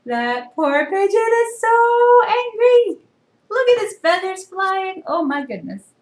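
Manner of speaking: high, slow, wide-eyed storytime voice; reading to children. Acted. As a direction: marvel with the kids. Reader reacting high, slow.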